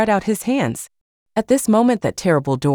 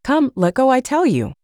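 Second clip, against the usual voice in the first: reproachful; 7 semitones higher than usual. A narrator's reading. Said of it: "with reproach and surprise".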